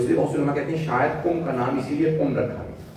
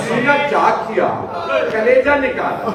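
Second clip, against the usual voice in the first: high, loud cry of grief; anguished shout; 10 semitones higher, 7 dB louder than usual. Acted. Preacher crying out Hamza's mutilation, anguished shout. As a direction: hurl each horror at the crowd. Climax of martyrdom account.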